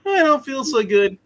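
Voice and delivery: high, loud woozy whine; Spidey. Acted. Game master voices Spidey bitten by Dracula, high sickly whine. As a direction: pitch it up, feeling sick. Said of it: "And Spidey's like".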